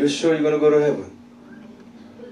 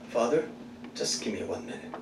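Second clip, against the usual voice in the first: hushed plea; 7 semitones lower, 15 dB quieter than usual. Acted. Imagined plea from the cross.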